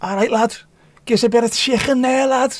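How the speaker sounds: hammed-up Scouse, high and bright; broad Scouse. Streamer showing off a Scouse voice to chat. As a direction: play a cheeky Liverpool lad ordering chicken. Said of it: "Scousers like me".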